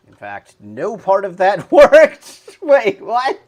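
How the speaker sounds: high, loud laughing in disbelief. Real, not acted. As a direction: laugh it off, can't believe it. Code fails, laughing disbelief.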